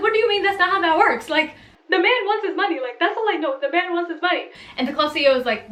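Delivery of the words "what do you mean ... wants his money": higher, insistent protest; mock indignant. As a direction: push back, worked up. Arguing with the club CEO.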